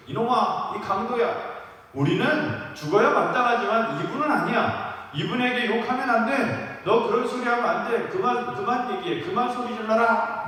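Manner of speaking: acted-out high-pitched angry telling-off; scolding. One criminal tells the other to stop, angry scolding. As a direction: scold him hard to make him stop. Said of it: "강도에게 욕을 퍼붓고 있는 것입니다".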